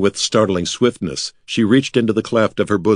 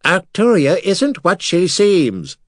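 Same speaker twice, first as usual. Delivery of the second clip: high, slow warning skull; 11 semitones higher, slower than usual. Acted. Skull Mira warning Artek about Arcturia, high and slow. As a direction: slowly warn in the skull voice.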